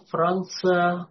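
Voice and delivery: ordinary talk, nothing marked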